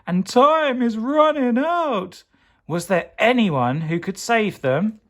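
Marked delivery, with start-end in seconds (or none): 0.0-2.2 very high, slow, stretched "I'm melting!"; pleading. Acted. Lolly melting in the freezer, slow high plea. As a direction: wail it out slowly.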